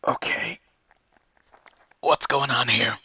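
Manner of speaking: resigned, sighing under the breath; weary sigh. Acted. Guard sighs at the odd group arriving, wearily. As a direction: let out a sigh, then ask wearily. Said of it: "one of them just sighs to himself".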